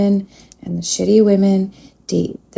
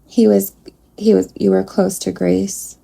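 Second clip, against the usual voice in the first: usual speaking voice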